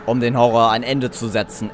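ordinary talk, nothing marked

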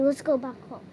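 ordinary talk

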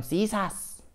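high, put-on street-gang reply; T-shirts answering together. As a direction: answer as a cocky little gang. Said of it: "y me dijeron".

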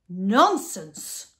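loud, explosive dismissal; roaring. A narrator's reading. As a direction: roar it out as an outraged doctor. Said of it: "the doctor roared".

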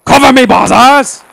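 put-on high, loud battle cry in German accent; cover me shout. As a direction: yell it as you charge forward. Charging in, calling for cover.

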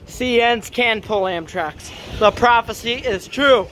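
slow, drawn-out solemn announcement; mock prophetic proclamation. Vlogger announcing CN trains can pull Amtraks, mock prophetic proclamation. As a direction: proclaim it slowly, like a prophecy fulfilled.

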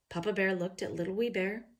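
ordinary delivery, nothing marked

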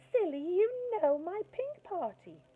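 put-on a sweet girl calling to her crush; sugary little girl. Angela sweetly correcting.

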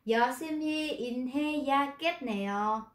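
Yoshimi inhayagetneo read very slowly, every syllable rigid; stiff wooden reading. Shown as a demonstration. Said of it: "Nó cứng đơ luôn".

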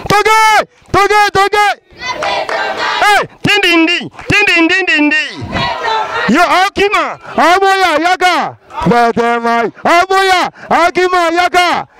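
rally the crowd with ringing cries; animator calling out. Acted. Animator calling to the crowd, high chanting shout.

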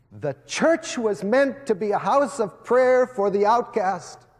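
preaching at full force. Acted. Preacher rousing Texas A and M students, preaching at full force. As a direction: preach it out to the whole hall.

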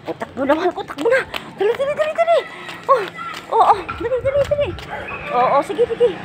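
high-pitched urging of the dog to hurry; high coaxing call. Real, not acted. Owner urging dog Mingay to hurry, high coaxing. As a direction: coax the dog along in a high excited voice.

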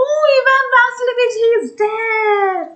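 high, loud acted wail; screaming. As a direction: scream the news in shock, stretching it out. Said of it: "And she screams".

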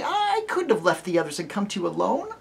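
put-on high pleading child; pleading girl. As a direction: beg him not to mean it. Lucy pleading.

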